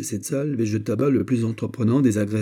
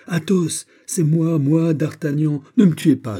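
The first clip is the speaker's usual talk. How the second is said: loud, high urgent call; crying out; 8 semitones higher, 6 dB louder than usual. A narrator's reading. D'Artagnan on a ladder calls to his besieged friend, crying out.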